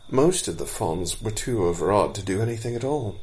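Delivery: ordinary delivery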